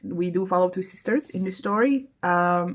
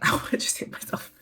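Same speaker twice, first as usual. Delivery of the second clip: breathy laughing whisper after a small bump; sheepish whisper; 4 dB quieter than usual. Real, not acted. Vlogger whispers after hitting herself by accident, sheepish whisper. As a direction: whisper it, embarrassed, through a laugh.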